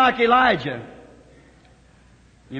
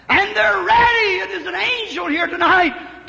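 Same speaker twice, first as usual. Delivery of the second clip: exultant cry; 6 semitones higher than usual. Real, not acted. Preacher on the deaf and dumb.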